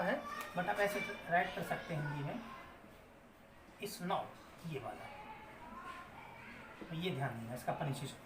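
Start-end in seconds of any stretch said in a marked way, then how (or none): none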